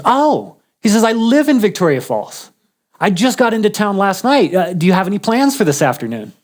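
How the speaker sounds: high, bright greeting from a friend; excited. Retold or imitated. Truck driver on the phone, delighted he's in town.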